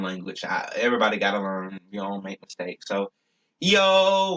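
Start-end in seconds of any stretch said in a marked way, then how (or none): 3.6-4.3 high, long-held "yo"; drawn-out calling. Pretend. Speaker demonstrates his word for goodbye, high and drawn out. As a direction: call it out on a high sustained note. Signing off in made-up language.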